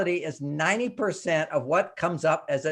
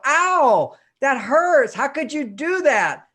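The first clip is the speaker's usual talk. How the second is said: higher and louder hammed-up cry of hurt; mock pained outburst; 7 semitones higher, 5 dB louder, slower than usual. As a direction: play the wounded one overreacting to a pat. Acting hurt at shoulder pat.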